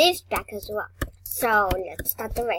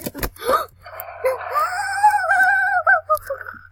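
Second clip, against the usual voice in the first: sharp high gasp of fright; loud frightened gasp; 7 dB louder than usual. Real, not acted. Scared player gasping at a game jump scare. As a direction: gasp out loud in sudden fear.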